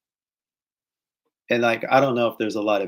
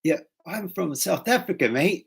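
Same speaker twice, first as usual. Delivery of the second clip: high-pitched mock South African introduction; exaggerated South African accent; 6 semitones higher than usual. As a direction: imitate a South African's matey correction. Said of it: "南アフリカの人は".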